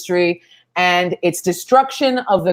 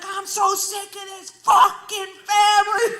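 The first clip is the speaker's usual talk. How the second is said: high, drawn-out angry shout; losing it; 11 semitones higher, slower than usual. Retold or imitated. Comic blows up at the arguing family, losing it. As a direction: act out a fed-up meltdown, pitched way up. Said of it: "I lose my shit".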